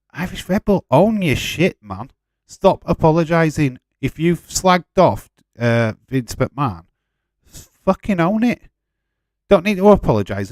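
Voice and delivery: a frustrated shout at a viewer who keeps saying sorry; exasperated loud rant. Real, not acted. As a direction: bark at him to stop apologising. Telling chatter to own it.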